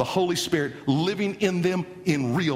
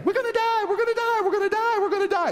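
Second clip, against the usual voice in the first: put-on girly-man screaming; faster than usual. Hardened criminals panic as the ship breaks apart, squealing high. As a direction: play tough criminals squealing like frightened girls. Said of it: "screaming like a bunch of girly men".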